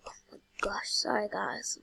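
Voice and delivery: half-asleep whisper; sleepy whispered apology; nodding off. Real, not acted. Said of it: "I'm half asleep".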